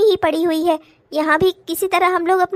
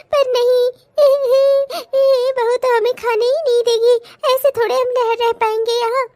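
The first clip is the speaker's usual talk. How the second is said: put-on higher, teary old mother; mother siding with her son, weeping; tearful mother; 5 semitones higher than usual. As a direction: complain with a crying edge. S1 plays the mother.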